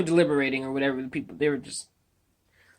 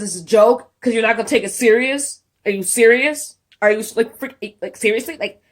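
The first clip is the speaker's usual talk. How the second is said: indignant outrage; 6 semitones higher, 9 dB louder than usual. Real, not acted. Outraged at the verdict.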